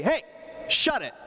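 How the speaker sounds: high, snappy mock shut-down; mock impatient rebuke to an interrupting host; curt brush-off. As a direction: toss it off as a rude dismissal. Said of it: "Jesus doesn't say".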